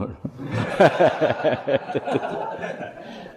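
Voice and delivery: a long breathy unvoiced giggle. Real, not acted. Preacher laughs at the congregation, long breathy giggle.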